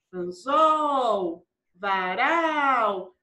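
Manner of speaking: "anzol, varal" with long, stretched last syllables; calling the words. Shown as a demonstration. Teacher shows pupils the stressed syllable, calling the words. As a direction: call out "anzol, varal", holding ZOL and RAL. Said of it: "que era chamar a palavrinha".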